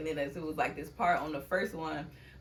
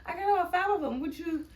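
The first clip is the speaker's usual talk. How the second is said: giddy brag; 11 semitones higher, 7 dB louder than usual. Retold or imitated. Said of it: "And I'm like".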